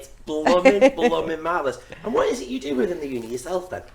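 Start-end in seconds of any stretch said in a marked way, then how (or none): 0.0-1.8 comic catchphrase. Pretend. Echoes catchphrase, louder.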